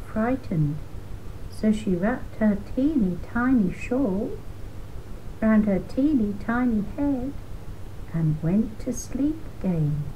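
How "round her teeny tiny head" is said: plain reading voice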